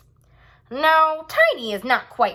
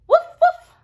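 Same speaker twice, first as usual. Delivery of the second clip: high, loud yappy woofs; squeaky puppy woof-woof; puppy bark; 8 dB louder than usual. Acted. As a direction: bark like a happy little puppy. Puppy barks at his new name.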